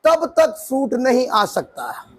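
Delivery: preacher slowing right down so every word lands; slow and deliberate. Shown as a demonstration. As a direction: slowly spell out each phrase. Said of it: "मैं धीरे धीरे बोल रहा हूं अब".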